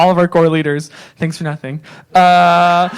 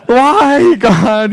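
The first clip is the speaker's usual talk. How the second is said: mock anguish; 5 semitones higher, 4 dB louder than usual. Retold anguished prayer.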